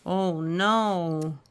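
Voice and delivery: ordinary delivery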